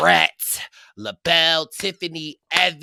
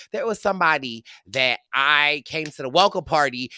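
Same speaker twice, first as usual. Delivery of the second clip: usual speaking voice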